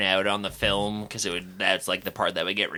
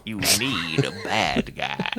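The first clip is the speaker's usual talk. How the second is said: slow, lower mock villain; slow menacing bad guy; 3 semitones lower, slower than usual. Joking in a put-on voice.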